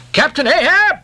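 high questioning call; Starbuck calling out. A narrator's reading. Starbuck hailing his captain, calling out. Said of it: "said Starbuck".

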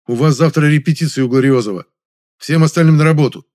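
rather stern. A narrator's reading. Man refuses his actress neighbour a drink, stern. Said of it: "я ответил чуть более жёстко".

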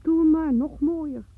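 loud scandalised sarcasm; indignant outburst. Acted. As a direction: throw it out in outrage.

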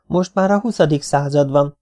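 plain reading voice

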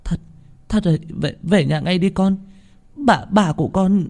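a mother breaking down on the phone; sobbing mother. A narrator's reading. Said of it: "âm thanh của mẹ nức nở". (giọng mẹ nức nở, nghẹn ngào)

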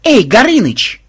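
loud, high hail; calling out. A narrator's reading. Programmer summons the dragon, loud high call. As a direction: shout into the cave.

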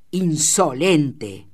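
loud, drawn-out outraged cry; beside himself, shouting. A narrator's reading. Boss enraged by the shearer's mockery, furious shout. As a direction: stretch the word out in loud fury. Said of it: "gritó fuera de sí".

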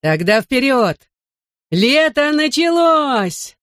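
high, drawn-out jubilant call; excited rallying cry. A narrator's reading. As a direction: launch everyone with a rising, stretched cry. Ben launches the summer flight ritual.

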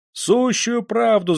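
high, drawn-out cry of assent; crowd crying out. A narrator's reading. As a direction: call back slowly and high from the crowd. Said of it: "закричали в народе". (крик из народа)

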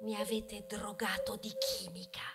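hushed bitter whisper; whispered accusation. Acted. Wheat accuses humans of drugging it, whispering. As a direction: breathe the accusation out.

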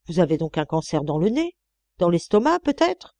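indignant questions, pitch leaping at the ends; scandalized old mistress, energetic and incredulous; appalled disgust. Acted. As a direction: recoil from a foul stench. Old woman recoils from Louise's smell.

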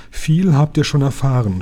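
ordinary delivery, nothing marked

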